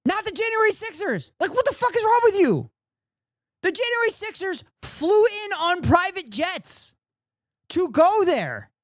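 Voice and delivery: high, heated outburst at the argument; incredulous rant. Real, not acted. Streamer raging at the debater, high outraged rant.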